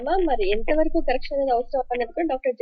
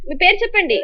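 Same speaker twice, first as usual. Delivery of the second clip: raised, higher voice to the caller; calling out; 7 semitones higher, 6 dB louder than usual. Real, not acted. Host asks a phone caller's name, calling out. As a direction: prompt loudly down a bad line.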